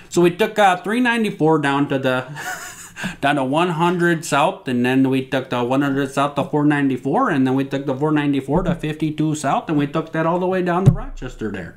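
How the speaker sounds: put-on light Minnesotan accent giving directions. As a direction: lay on a mild Minnesota accent. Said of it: "Let me do my Minnesotan accent".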